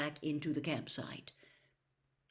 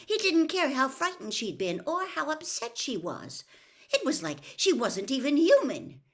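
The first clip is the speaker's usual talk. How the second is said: high, loud indignant rant; furious rant; 8 semitones higher, 5 dB louder than usual. A narrator's reading. Hermione to Mister Weasley about Winky, furious rant. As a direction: rage on the injustice.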